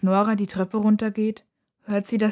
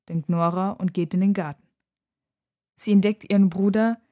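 ordinary delivery

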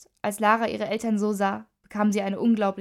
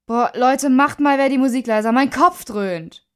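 loud, whining complaint, dragged out at the end; loud groan; 4 semitones higher, 7 dB louder than usual. A narrator's reading. Boy with a throbbing head to his friends, groaning. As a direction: groan it at your noisy friends. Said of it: "stöhnte Tom".

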